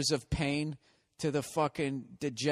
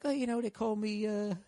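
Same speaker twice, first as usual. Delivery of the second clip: imitated hurt kid; 8 semitones higher than usual. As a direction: put on a small, high voice for a hurt kid. Retold hurt kid on a show.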